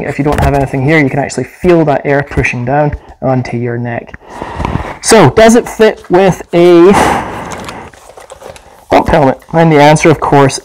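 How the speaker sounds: muffled behind face mask; breathing through filter mask; through a gas mask. Real, not acted. Seen 1: Gas mask worn over face throughout, including during helmet handling.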